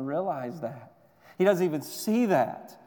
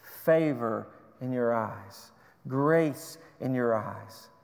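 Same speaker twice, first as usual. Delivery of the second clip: slow and hushed; 4 semitones lower, slower than usual. Shown as a demonstration. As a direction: let favor and grace settle gently.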